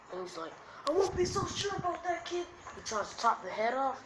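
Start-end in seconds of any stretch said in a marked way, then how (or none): 0.9-2.5 high-pitched villain voice; villain's retort. Acted. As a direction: answer back in a high put-on voice.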